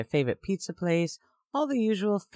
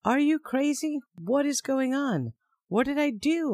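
panicked; 10 semitones higher than usual. Retold or imitated. Panicked confusion at Mike.

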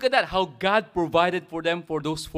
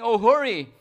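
eager plea; 5 semitones higher, 8 dB louder than usual. Retold or imitated. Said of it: "It's like they're saying".

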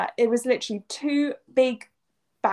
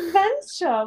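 high sarcastic echo of the word; sarcastic repeat; mock adventurous; 8 semitones higher, 3 dB louder than usual. Real, not acted. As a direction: throw the word back with a sarcastic lift. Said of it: "echoing with mock excitement".

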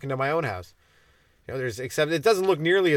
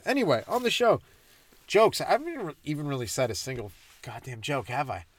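ordinary talk, nothing marked

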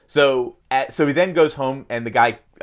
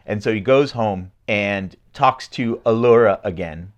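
usual speaking voice

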